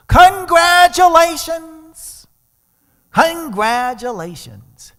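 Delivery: very high, loud, slow proclamation; jubilant proclaiming. Retold or imitated. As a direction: herald it like a joyous announcement. Celebrating voice outside window.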